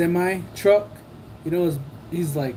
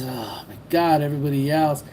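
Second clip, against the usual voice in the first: ordinary talk, nothing marked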